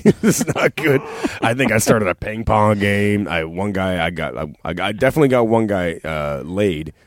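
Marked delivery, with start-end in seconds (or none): none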